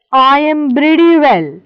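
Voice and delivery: slow, emphatic reading in a put-on accent; slow reply. A narrator's reading. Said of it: "he said slowly".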